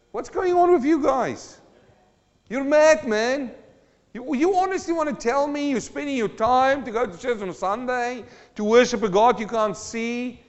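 mock incredulous jeer; mocking unbeliever. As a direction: scoff at the churchgoer in disbelief. World mocking churchgoers.

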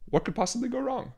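high, soft sarcastic quip. Acted. Sarcastic aside on escape room.

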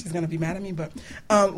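ordinary delivery

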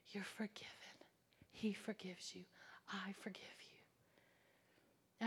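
soft reassuring whisper; tender whisper. Retold or imitated. Preacher reassuring the weeping inmate in a tender whisper. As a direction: comfort her gently, barely voiced.

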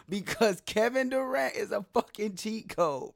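fired up about the star player; loud, high, worked-up protest; excited rant. Real, not acted.

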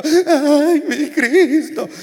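half-laughing mock lament; mock crying. Preacher imitates the crying sister, mock sobbing through laughter. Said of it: "llore y llore".

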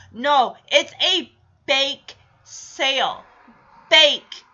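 slow, with "bake" set apart and hit hard; stress on "bake". Real, not acted. Host objecting to store cookies for the sale, heavy stress on "bake". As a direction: punch "bake" and let it stand alone. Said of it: "Emphasis on the word bake".

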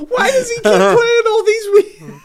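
high, loud laughing question. Real, not acted. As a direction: crack up while asking.

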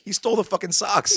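high, exasperated outburst; incredulous punchline. Retold or imitated. Exasperated punchline of retold story.

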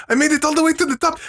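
very high and quick, proud of himself; high proud old guy. Retold or imitated. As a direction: crow it out high and fast. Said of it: "He's like".

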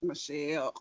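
whispered name, breathless; awed whisper. Retold or imitated. Barack seeing Michelle's pantsuit, an awed whisper. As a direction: say her name under your breath in awe. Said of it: "he was like".

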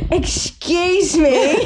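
drawn out posh British, laughing; slow overdone British accent; mock British accent. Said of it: "what British people say".

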